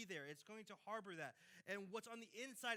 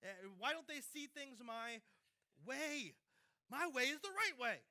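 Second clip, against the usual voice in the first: indignant pushback; 5 semitones higher, 8 dB louder than usual. Retold or imitated. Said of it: "we push back, say".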